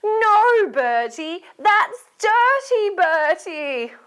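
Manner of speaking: high, loud, drawn-out telling-off; scolding. Acted. Storyteller leading children to tell Bertie off, scolding. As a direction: wag a finger and scold in singsong. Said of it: "We better tell him".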